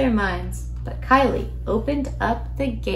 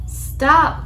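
higher, louder call to halt; storybook shout; 5 semitones higher, 6 dB louder than usual. A narrator's reading. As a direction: call out sharply to someone leaving. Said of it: "called Witch and Skeleton".

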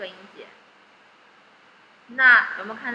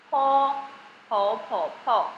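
"po" repeated slowly, level and held like Chinese first tone; stressed syllable po; much slower than usual. Shown as a demonstration. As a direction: hold "po" flat and steady like 一声, four times. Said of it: "这个P要发 中文的像一声一样". (像中文一声一样慢慢重复"po")

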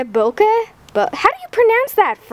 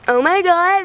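ordinary talk

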